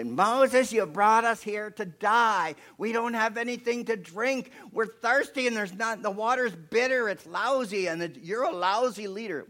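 high, loud griping mob; loud complaining. Acted. Thirsty people blaming Moses, loudly complaining. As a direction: pile on the accusations, whining up high. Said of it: "they start to complain again".

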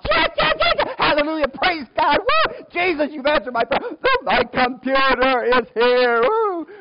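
high, loud whooping celebration; wild acted-out hallelujah shouts; jubilant shouting. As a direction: shout it like a lottery winner. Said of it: "I'm still shouting hallelujah".

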